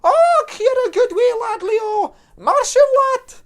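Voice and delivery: very high, loud gushing Scottish mum; broad Scottish accent. Acted. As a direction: gush over him in a shrill Scots voice.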